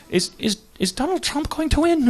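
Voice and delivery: put-on high, lilting Irish accent. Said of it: "I can't do an Irish accent".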